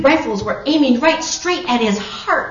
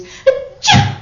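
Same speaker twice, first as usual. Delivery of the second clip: loud, high mock achoo; explosive pretend sneeze; acted sneeze; 9 dB louder than usual. A narrator's reading. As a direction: burst out in a storybook sneeze. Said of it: "said with a sneeze".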